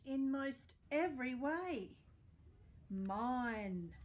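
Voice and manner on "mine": a grumpy dog's low, drawn-out "Mine"; possessive pug. Acted. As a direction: claim it like a greedy little pug. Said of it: "says his bulb".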